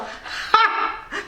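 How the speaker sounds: breathless high nervous giggle; real nervous laugh with no voice; airless nervous giggle. Real, not acted. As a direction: silent shaking laugh. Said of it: "Je ris, c'est nerveux là".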